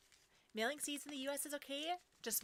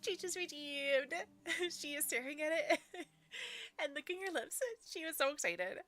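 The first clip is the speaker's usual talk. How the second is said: higher, louder, delighted and laughing; excited, laughing; 5 semitones higher, 4 dB louder than usual. Real, not acted. Streamer watching her dog lick her lips, excited and laughing. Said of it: "It makes me excited for her".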